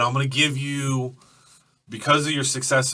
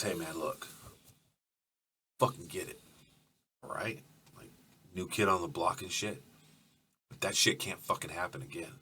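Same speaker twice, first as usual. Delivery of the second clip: lower, much softer private word; low quiet warning; 6 semitones lower, 12 dB quieter than usual. Acted. Big Gus quietly warning the new guy, low and soft. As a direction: pull him aside and speak low. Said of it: "He goes".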